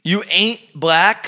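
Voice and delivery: high, slow disbelief; incredulous echo. Real, not acted. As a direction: repeat it in disbelief. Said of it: "incredulous echo".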